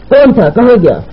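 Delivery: high-pitched, sharp interrogation; angry. Acted. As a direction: fire the questions angrily. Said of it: "غصیلے لہجے میں بولا". (غصیلے لہجے میں)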